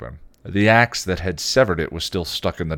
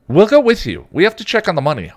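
very high, loud and fast eager voice; high Anya voice; 13 semitones higher, 10 dB louder than usual. A narrator's reading. Anya insisting to Buffy, high and fast. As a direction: insist on coming for the money.

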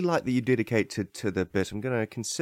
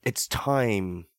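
ordinary talk, nothing marked

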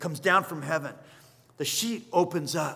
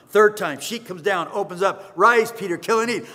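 louder, rising to the third command; building loud retelling; 2 semitones higher, 7 dB louder than usual. Retold or imitated. Preacher telling the third vision, loud and building. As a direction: push the third time hard.

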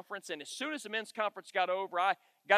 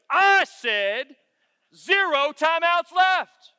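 much louder, higher, drawn-out booming call; imitated referee's shout; 9 semitones higher, 13 dB louder, much slower than usual. Referee overruling a coach about timeouts, imitated angry shout. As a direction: bellow it like an indignant referee.

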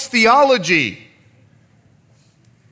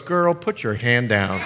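low-pitched imitation of a mom's order; scolding mom; 8 semitones lower, 4 dB quieter than usual. Mom to girl who raised her hand, imitated scolding. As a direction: drop the pitch like a mother correcting her child. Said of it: "the mom looked at the girl and goes".

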